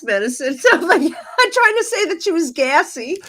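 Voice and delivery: telling it through real laughter; laughing while talking. Real, not acted. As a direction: barely get the punchline out for laughing. Laughing at own funny story.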